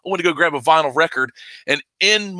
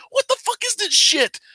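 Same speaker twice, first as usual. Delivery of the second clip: outraged disbelief. Retold or imitated. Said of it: "I'm like".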